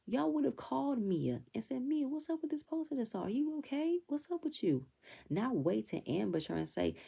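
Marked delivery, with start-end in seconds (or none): 1.8-4.9 higher, concerned friend voice; lightly voiced sympathetic friend; mock caring check-in. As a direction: sound like a worried friend calling. How they should have asked.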